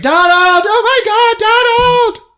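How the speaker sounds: very high, slow, sung-out wail; mock anguish over a fallen husband; wife wailing over her knocked-out husband, very high and drawn out; hammed-up wail.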